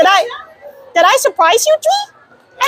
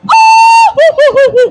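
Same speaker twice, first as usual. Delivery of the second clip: loud high cartoon hoo-hoo laugh; Goofy laugh; 7 semitones higher, 7 dB louder than usual. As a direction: whoop it out like a goofy cartoon dog.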